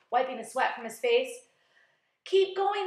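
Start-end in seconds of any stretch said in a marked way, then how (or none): 2.2-2.9 high, loud urging; crying out. A narrator's reading. Crew urging James to continue, crying out. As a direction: cheer someone on at full voice. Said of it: "they cried".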